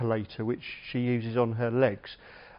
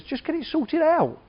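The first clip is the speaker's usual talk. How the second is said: much higher-pitched mock telling-off; imitated wife; 13 semitones higher than usual. Physio wife to husband with a pulled muscle, imitated scolding. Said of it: "she just says".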